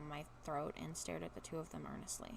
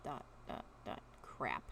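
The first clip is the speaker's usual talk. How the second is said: ordinary delivery, nothing marked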